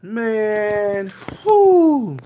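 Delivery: lightly overacted reactor; mock overwhelmed. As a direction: act floored by the music. Parody reactor overwhelmed again.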